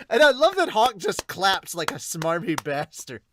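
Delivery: usual speaking voice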